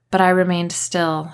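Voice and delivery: ordinary delivery, nothing marked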